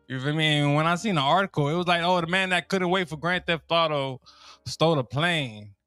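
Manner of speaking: ordinary talk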